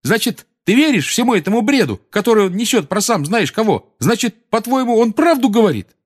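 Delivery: high-pitched incredulous anger; yelled challenge. A narrator's reading. Seamus asking Ron if he believes that nonsense, angry. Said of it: "заорал Симус".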